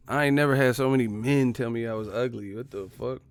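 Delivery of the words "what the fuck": usual speaking voice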